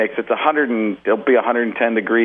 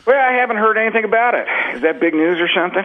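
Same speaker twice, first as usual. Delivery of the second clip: higher-pitched presidential impression; George W. Bush; 9 semitones higher, faster than usual. Comic answering the radio host's immigration question as George W. Bush. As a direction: answer high, as a clueless president. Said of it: "what President Bush thinks".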